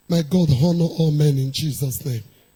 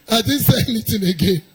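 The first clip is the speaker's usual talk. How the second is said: usual speaking voice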